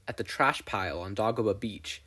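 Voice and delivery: usual speaking voice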